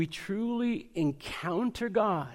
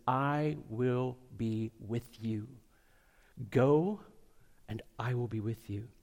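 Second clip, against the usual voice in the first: gentle voice of God; 5 semitones lower, 4 dB quieter than usual. Acted. God's tender call to Moses.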